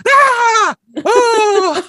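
hammed-up gasping shriek of shock; mock shriek. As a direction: scream in horror like the mom. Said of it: "Just, like, shrieking".

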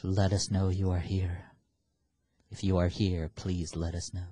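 low, solemn plea to a spirit; séance invocation. Pretend. Addresses spirit on Ouija board.

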